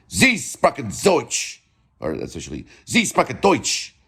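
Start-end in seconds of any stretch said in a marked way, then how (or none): 0.1-1.6 higher, slow, harsh German demo; exaggerated harsh German accent. Shown as a demonstration. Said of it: "They can be that way". 2.8-3.9 higher, forceful German demo; exaggerated harsh German accent. Shown as a demonstration. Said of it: "They can be that way".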